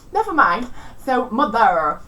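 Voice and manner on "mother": loud "mother" with a pronounced final R; West Country R. Shown as a demonstration. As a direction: hold the R at the end of "mother". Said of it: "the R sound is more pronounced".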